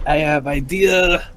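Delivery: slow, drawn-out character voice; Bellix. Acted. Player slipping into an old character while offering a plan, putting on a voice. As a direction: stretch it out in a put-on voice. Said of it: "I sound like Bellix again".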